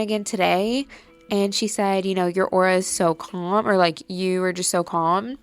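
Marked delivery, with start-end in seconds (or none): none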